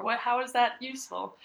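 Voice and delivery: ordinary talk, nothing marked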